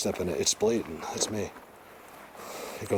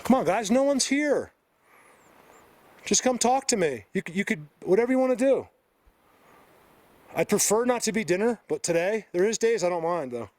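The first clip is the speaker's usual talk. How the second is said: calling out; 11 semitones higher than usual. Real, not acted. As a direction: call out high to someone unseen. Calling out to unseen creatures.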